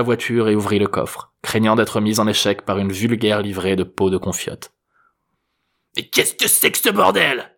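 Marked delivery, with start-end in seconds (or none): 6.0-7.5 put-on hoarse old man's high-pitched protest; hoarse outcry. A narrator's reading. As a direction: cry out in a husky, cranky voice. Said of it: "s'écria une voix enrouée".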